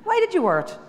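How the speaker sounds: high, self-deprecating brush-off; hammed-up modest brush-off; mock dismissive reply. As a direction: act out waving off a compliment. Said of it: "Somebody will say".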